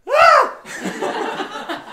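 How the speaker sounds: very high, loud comic shriek; short high-pitched hammed-up scream; mock yell. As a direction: cry out in exaggerated shock. Acts own cry as truck passes.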